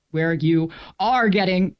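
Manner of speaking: ordinary talk, nothing marked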